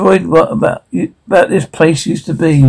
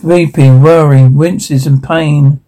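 ordinary talk

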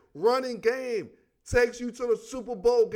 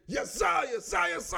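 mimicking friend mocking; 9 semitones higher than usual. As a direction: parrot it back high and teasing. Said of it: "He be like, why do you always say".

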